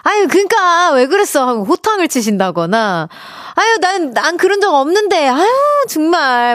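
high, mock-fussing mother; fussing mom. Mother covering her gratitude with mock fussing, lightly voiced and high. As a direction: wave off thanks like a shy mom. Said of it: "어머니들이 반대로 표현하시더라고요".

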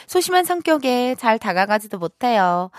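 normal talking voice

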